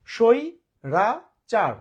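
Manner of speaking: স্বৈরাচার stretched very slowly into three loud beats; syllable by syllable. Shown as a demonstration. Teacher proving the syllable count, saying স্বৈরাচার slowly in three separate parts. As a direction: split স্বৈরাচার in three, pausing after each syllable. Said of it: "তিনবার উচ্চারণ করছে".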